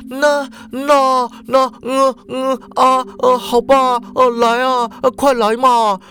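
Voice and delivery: high, halting fake moans; mock coy woman. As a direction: act out reluctant surrender, hammed up. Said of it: "然后你就说". (夸张模仿女生半推半就的娇喘)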